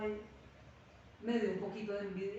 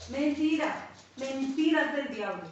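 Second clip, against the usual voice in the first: loud denunciation; 3 semitones higher, 6 dB louder, slower than usual. Real, not acted. Preacher denouncing the devil's lie.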